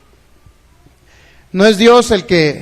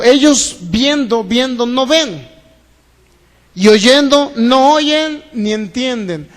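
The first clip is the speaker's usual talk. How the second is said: slow deliberate quote; slower than usual. Acted. Preacher spelling out Jesus' words.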